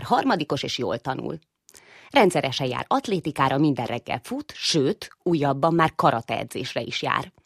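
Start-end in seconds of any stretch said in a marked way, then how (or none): none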